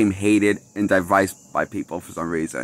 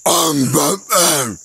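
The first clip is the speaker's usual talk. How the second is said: slow, strained hoarse superhero growl; hoarse, higher, constipated-sounding hero; Batman; 4 semitones higher, slower than usual. Acted. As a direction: attempt a strained superhero voice, drawn out. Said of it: "This is how he sounds".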